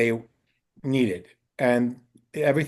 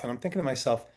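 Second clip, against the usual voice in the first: normal talking voice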